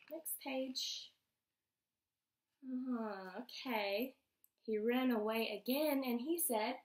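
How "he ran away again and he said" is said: ordinary delivery, nothing marked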